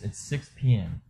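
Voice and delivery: ordinary delivery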